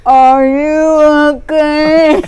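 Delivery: very slow, drawn-out and loud; slow motion. Retold or imitated. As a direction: stretch every word like slow motion. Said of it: "I don't normally speak in slow motion".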